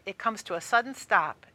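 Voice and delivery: ordinary delivery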